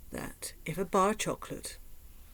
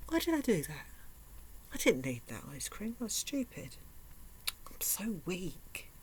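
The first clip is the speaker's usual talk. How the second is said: self-reproach; 6 dB quieter than usual. Retold or imitated. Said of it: "you'll think".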